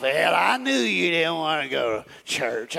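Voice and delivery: a hint of the devil's low voice; devil posing as preacher. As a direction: slyly taunt like a fake preacher. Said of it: "You'll think he's a, a preacher".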